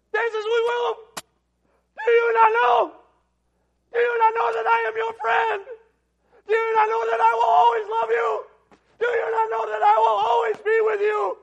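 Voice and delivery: high, loud tearful movie shout. Retold or imitated. Preacher acting out the movie farewell, high tearful shout. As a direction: shout it across the valley like the film hero. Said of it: "And he's just shouting it".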